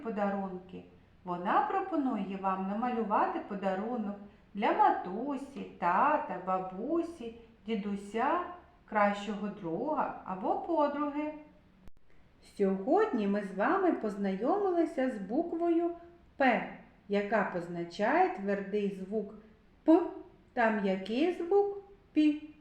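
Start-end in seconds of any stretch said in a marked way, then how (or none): none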